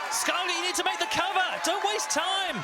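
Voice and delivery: pleading with the wrestler; urgent. Real, not acted. Commentator urging wrestler.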